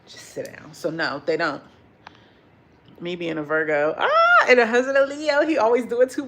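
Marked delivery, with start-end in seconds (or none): 0.1-0.7 a quiet firm sit down; hushed scolding. Retold or imitated. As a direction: settle them down under your breath. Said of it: "They be telling them".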